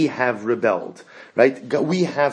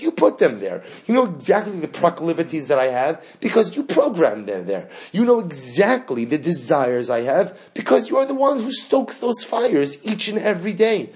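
louder and higher, defiant appeal; bold plea; 5 semitones higher, 4 dB louder than usual. Acted. Worshipper speaking boldly to God, defiant and loud. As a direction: stand up to God with chutzpah.

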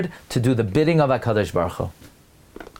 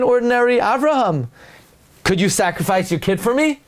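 high, offhand calling voice; a casual, coaxing call; high, wheedling divine voice; voice of God, casual; 6 semitones higher than usual. Acted. God calls plainly, voiced.

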